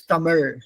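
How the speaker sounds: Tamil with a retroflex final l; retroflex final sound. Shown as a demonstration. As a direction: curl the tongue up for the end of Tamil. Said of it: "The l is from the tongue at the top of the roof of the mouth".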